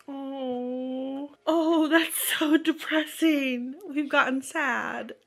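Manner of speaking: high, drawn-out mock wail; mock dismay. Real, not acted. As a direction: wail it slowly, hamming up the sympathy. Mock-wailing reaction to sad backstory.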